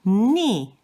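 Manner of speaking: short "ni" starting high and falling sharply; "ni" with a steep falling tone; fourth tone. Shown as a demonstration. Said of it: "the fourth one is".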